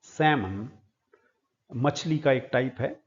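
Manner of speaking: usual speaking voice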